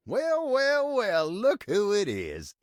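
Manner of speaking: high, drawn-out Southern "well, well"; singsong Southern welcome; mock-surprised greeting. Greets someone arriving in foyer.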